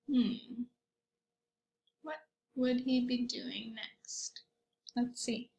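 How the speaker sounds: soft secretive whisper; whispered wonder. Real, not acted. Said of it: "whispered question to children".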